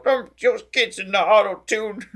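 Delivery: a hint of cranky old man; grumpy old man. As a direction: grumble it like a grouchy grandpa. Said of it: "This is me being my grumpy old man".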